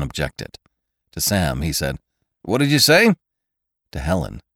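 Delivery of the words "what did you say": loud, high-pitched question; raised voice on a phone call; phone shout. A narrator's reading. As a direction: call it sharply down the line. Barked into the phone to Sam.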